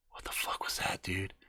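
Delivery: awed whisper. Acted. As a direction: breathe it out, barely voiced. Hushed amazement after the chaos.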